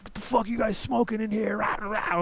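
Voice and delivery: high, shouted mock rage from the dad; imitating the pissed-off biker dad; angry dad yelling. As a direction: bark it like a furious father bursting in. Angry dad bursting into room.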